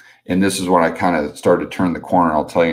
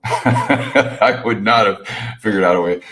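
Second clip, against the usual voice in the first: slow, laughing no-way reply; chuckling, amused disbelief; laughing denial; 4 semitones higher, slower than usual. Retold or imitated. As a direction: laugh it off and spell out that you'd have died. Retorting to rescuer, laughing.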